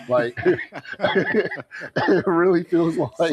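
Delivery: words pushed out through laughter; laughing. Real, not acted. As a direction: crack up while trying to explain. Laughs describing his overwhelmed state.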